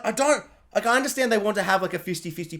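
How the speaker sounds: ordinary delivery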